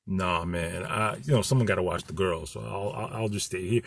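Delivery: deep, hushed mock Rufus backing out. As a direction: mumble it low like you want out. Scared Rufus voiced in story.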